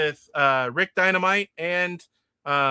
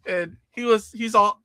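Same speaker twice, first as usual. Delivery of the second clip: ordinary delivery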